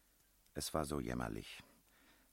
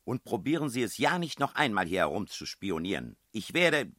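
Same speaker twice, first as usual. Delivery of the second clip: high, loud outraged shouting; landlady throwing the guests out; furious tirade; 9 semitones higher, 10 dB louder than usual. A narrator's reading. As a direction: threaten them with police, full rage.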